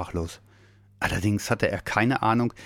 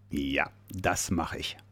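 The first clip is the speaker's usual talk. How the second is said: low, slow, softer bear voice; grinning bear; 5 semitones lower, 6 dB quieter, slower than usual. A narrator's reading. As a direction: agree slowly, deep and quiet, with a grin. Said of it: "Der Nordpolarbär grinste". (tiefer, langsamer Bär, grinsend)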